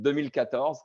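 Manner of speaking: French accent, crisp and proud. Shown as a demonstration. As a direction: say it like a native French speaker. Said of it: "I'm gonna take my best French accent".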